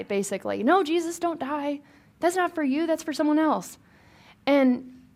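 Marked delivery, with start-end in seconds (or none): 0.6-3.8 higher, pleading objection; Peter protesting. Retold or imitated. Teacher voicing Peter protesting to Jesus, higher and pleading. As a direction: plead with him not to go through with it. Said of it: "Peter is standing there giving him the business".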